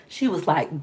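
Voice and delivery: normal talking voice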